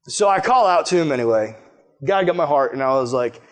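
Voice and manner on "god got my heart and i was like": usual speaking voice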